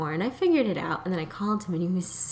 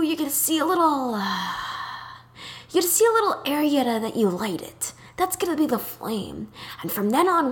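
high, half-whispered exasperated landlord with sighs; breathy high-pitched mock of an exasperated old man; exasperated David; 6 semitones higher than usual. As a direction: sigh through it like a weary, fed-up landlord. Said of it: "and he'd sound like this".

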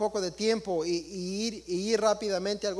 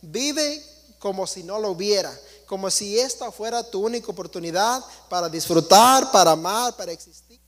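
voicing their God; 9 dB louder than usual.

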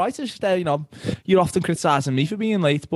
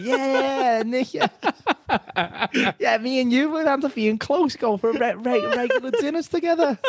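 lightly voiced tipsy pal, higher, laughing through it; mock tipsy enthusiasm, raised pitch; tipsy Redknapp; 7 semitones higher than usual. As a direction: gush like a mate after champagne, pitched up. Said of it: "had a few champagnes, and he's like".

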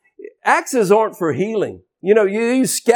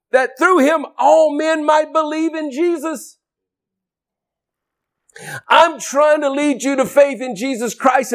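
higher, ringing sermon climax; fervent preaching; 5 semitones higher than usual. Acted. Preacher calling the crowd to be set free, ringing. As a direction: lift it like a revival call.

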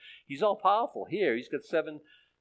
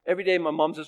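ordinary talk, nothing marked

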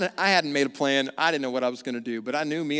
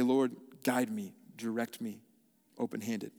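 humble prayer; 3 semitones lower, 6 dB quieter than usual. Acted. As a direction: pray it quietly, open-handed. Surrendered prayer quote.

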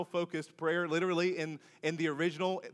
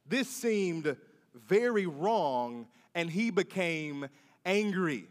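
slow dramatic reading; 5 semitones lower, slower than usual. Acted. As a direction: draw out each word slowly. Pastor reads verse with weight.

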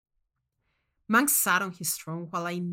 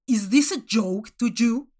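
angry judge; 8 dB louder than usual. Acted. Judge Manx angry at contestant.

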